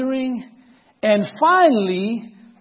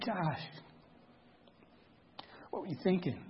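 gentle question; 4 semitones lower, 18 dB quieter than usual. Retold or imitated. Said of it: "I wasn't scolding him".

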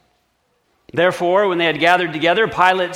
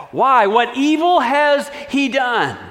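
raised, slow incredulous plea; lifted, slower pleading question; governor protesting; 8 semitones higher, 5 dB louder, slower than usual. Acted. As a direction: push back against the mob.

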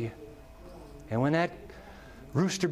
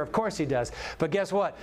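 ordinary talk, nothing marked

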